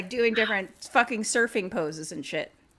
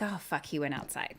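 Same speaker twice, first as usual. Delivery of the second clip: much softer, dismayed; hushed alarm; 6 semitones lower, 11 dB quieter than usual. Acted. Group realizing the leprechaun escaped, hushed. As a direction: say it under your breath. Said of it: "they're like".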